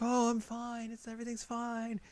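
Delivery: very high, put-on reassurance; breezy denial. She brushes off her fall.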